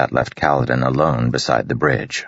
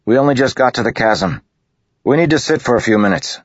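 higher, louder call across the gap; calling back; 10 semitones higher, 4 dB louder, faster than usual. A narrator's reading. Kaladin calling across to Matal, loud and raised. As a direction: project it to the officer. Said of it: "Kaladin arguing with Matal".

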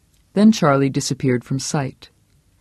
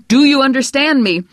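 much louder, higher shout; furious repeat; 11 semitones higher, 6 dB louder than usual. Acted. Husband yelling the question again over her prayer. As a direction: yell the question a second time. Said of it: "repeated louder".

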